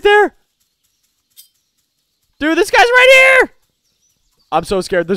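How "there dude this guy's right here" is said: very high, loud alarm; real startled shriek; panicked yell. Real, not acted. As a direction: shout the warning in a fright. Enemy suddenly close.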